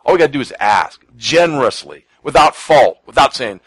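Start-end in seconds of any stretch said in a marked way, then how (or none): none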